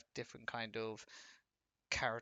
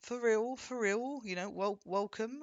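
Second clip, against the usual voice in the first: mock high voice; 8 semitones higher, 5 dB louder, slower than usual. Mimicking the high voice.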